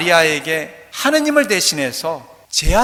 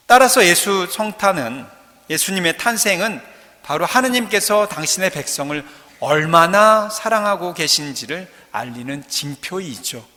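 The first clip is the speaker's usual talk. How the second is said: ordinary talk, nothing marked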